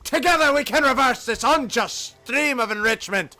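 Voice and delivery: rousing Scottish rally speech, key words punched; rally the workers in a Scottish accent; Scottish freedom fighter. Acted. Union organizer urging workers to revolt, rousing Scottish accent. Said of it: "Scottish freedom guy".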